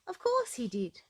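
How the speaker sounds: a cheeky, knowing reply; playful. A narrator's reading. Playful answer to own question.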